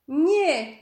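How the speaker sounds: high, loud desperate shout; desperate cry. A narrator's reading. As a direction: scream it to stop them. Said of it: "zawołała Jasmin". (wysoki, głośny rozpaczliwy krzyk)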